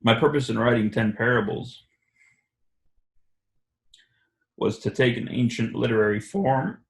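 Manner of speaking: reading aloud, halting and emotional; choked up. Real, not acted. Reading a quote to the group, choked up. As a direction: voice catches upward on the last word. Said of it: "I'm emotional".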